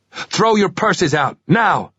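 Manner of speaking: put-on slow, commanding American accent; barking orders in a fake American voice; menacing American robber demand. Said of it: "My American accent was quite good".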